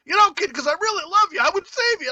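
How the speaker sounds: very high-pitched imitated pledge; hammed-up devoted friend; mock heartfelt declaration. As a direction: gush it high, imitating an eager buddy. Imitating friend's untimely love declaration.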